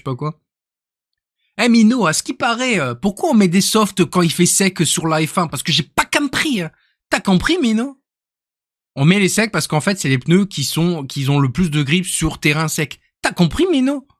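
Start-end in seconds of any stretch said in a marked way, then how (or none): 1.6-8.0 higher, lively put-on voice; caricature impression voice. Shown as a demonstration. Streamer impersonating someone quizzing Mino about tyres, higher caricature voice. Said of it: "je sais faire aussi". 9.0-14.1 caricature impression voice. Shown as a demonstration. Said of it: "lui, il me tue".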